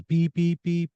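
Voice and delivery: high monotone beeps; phone beeping. Retold or imitated. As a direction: imitate a phone alert, evenly spaced. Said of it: "start beeping".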